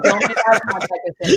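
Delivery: laughing inner thought. Retold or imitated. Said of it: "I was like".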